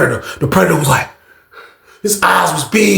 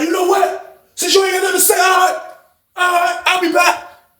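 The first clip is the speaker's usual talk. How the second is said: threatening shout; 13 semitones higher than usual. Retold or imitated. Angry inmate threatening.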